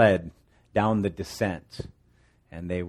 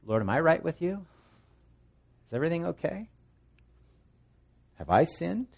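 much higher, gentle searching questions; humble asking; 8 semitones higher than usual. Retold or imitated. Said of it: "I should first ask".

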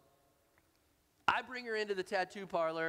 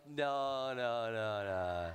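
each no sinking lower and lower; falling refusals; 7 semitones lower, much slower than usual. Retold or imitated. Son to mom about the tattoo, no after no sinking lower. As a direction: shut her down, no by no, dropping pitch.

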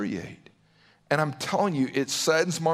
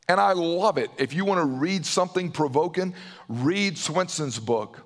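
normal talking voice

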